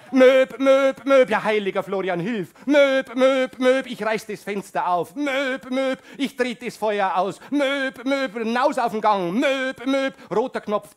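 high loud beeping alarm; mock fire alarm sound; alarm imitation. Alarm beeping during actions.

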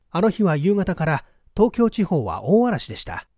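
ordinary delivery, nothing marked

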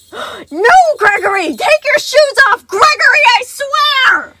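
very high, very loud frantic shouts; yelling after. A narrator's reading. Sun yelling after Gregory, shrieking. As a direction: yell at a runaway child. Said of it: "Sun yelling after him".

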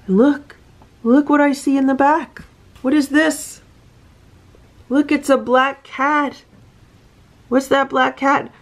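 excited reader spotting cats in the picture. Real, not acted.

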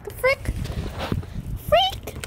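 very high startled yelp; high squeaky shocked cry; startled shriek. Real, not acted. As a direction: yelp it out in surprise.

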